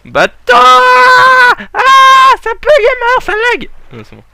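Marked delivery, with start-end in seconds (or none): 0.1-0.5 loud shout at the boat. Real, not acted. Gamer yelling at his boat in the game. 0.5-3.7 very high, very loud squeal; shocked shriek. Real, not acted. Gamer shrieking when his boat bugs out.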